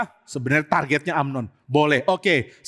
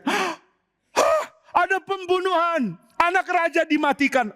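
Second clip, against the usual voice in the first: much higher, gasping alarm; shocked panic; 11 semitones higher than usual. Acted. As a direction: cry out in sudden horror. Princes see the murder.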